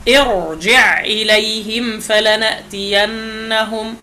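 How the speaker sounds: slow recitation of ارجع إليهم فلنأتينهم with a thick ra; heavy ra recitation. Shown as a demonstration. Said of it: "بهذا التفخيم". (تلاوة بطيئة بتفخيم الراء في ارجع)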